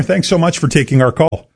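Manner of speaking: usual speaking voice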